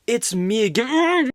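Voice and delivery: hammed-up intro. Video intro greeting.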